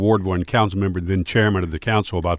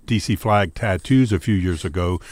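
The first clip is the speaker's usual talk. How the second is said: ordinary delivery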